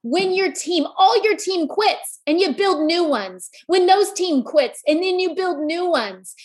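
rally the crowd like a sermon; preaching at full force. Acted. Speaker to audience, rousing preaching.

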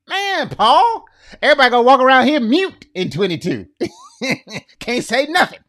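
high, loud, fast joking outburst; excited exclaim. Real, not acted. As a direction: laugh it off in disbelief.

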